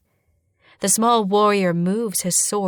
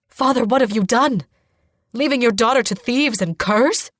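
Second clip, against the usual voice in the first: raised, loud accusing question; appalled reproach; 4 semitones higher, 5 dB louder than usual. A narrator's reading. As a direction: confront the father in outrage. Brother appalled at father's decision.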